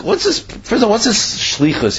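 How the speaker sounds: rhetorical surprise. Real, not acted. Teacher poses the question to listeners, astonished. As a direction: feign astonishment at the verse.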